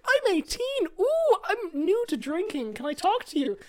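high, slow drunk girl impression; girly voice. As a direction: play the tipsy eighteen-year-old. Drunk girl quoted in story.

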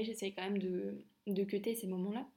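ordinary talk, nothing marked

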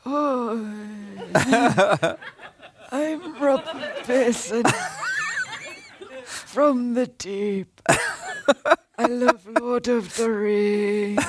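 slow, drawn-out mock voice through laughter; teasing imitation, laughing; Rob Pearson impression. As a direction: send up a friend lovingly. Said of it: "the impression of you is".

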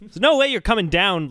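ordinary talk

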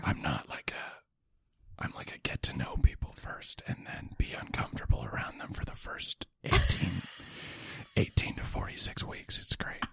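soft joking whisper; ASMR whisper. Pretend. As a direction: whisper with a little laugh.

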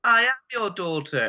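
high, lightly voiced creepy girl; little girl voice. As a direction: pitch up like a spooky child.

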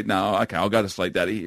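put-on low, subdued imagined toddler; meek surrender. Son imagined giving in to dad, meek. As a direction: give in meekly, voice dropped.

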